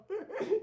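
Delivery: high burst of laughter. Retold or imitated.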